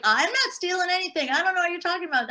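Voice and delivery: high, fast indignant denial; defensive denial. Retold or imitated. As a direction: rattle it off high and quick, playing innocent. Thief denies stealing, defensive.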